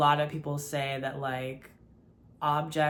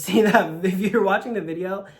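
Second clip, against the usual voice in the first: spooked and shaky; 4 semitones higher, 9 dB louder than usual. Real, not acted. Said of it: "that was genuine fear".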